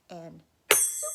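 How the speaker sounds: loud rising zoop; magic zoop. Acted. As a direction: make the chair vanish with a zing. Magic wand sound.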